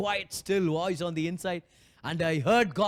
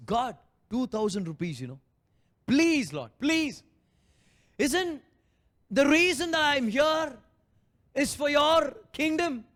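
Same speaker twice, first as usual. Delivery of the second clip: higher, louder desperate plea to God; pleading prayer; 7 semitones higher, 4 dB louder than usual. Retold or imitated. Preacher retelling his frustrated prayer for money, pleading. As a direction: beg God, voice climbing.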